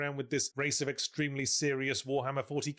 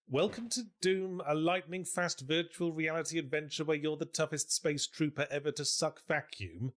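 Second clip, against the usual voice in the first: mock ad read; 3 semitones higher than usual. Reading old game manual aloud.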